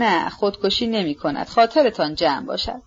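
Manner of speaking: plain reading voice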